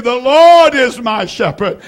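"Lord" held high and loud in a proclaiming cry; "The Lord is my shepherd" proclaimed high and loud; triumphant shouted declaration; loud proclamation. Real, not acted. Preacher proclaiming the psalm.

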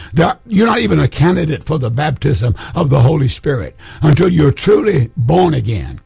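ordinary talk, nothing marked

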